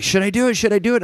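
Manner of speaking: high-pitched, eager repeated question; mock an over-eager guy itching to act; imitating excited firefighter. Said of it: "gets all excited".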